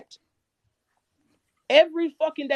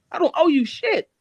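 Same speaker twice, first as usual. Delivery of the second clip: ordinary talk, nothing marked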